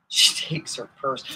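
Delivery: normal talking voice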